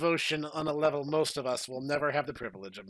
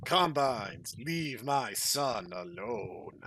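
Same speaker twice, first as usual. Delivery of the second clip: lower, very slow commanding voice; calm and grave, protecting his son; stern father; 4 semitones lower, much slower than usual. Acted. John Freeman warns the Combines off his son, low and slow. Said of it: "John Freeman said".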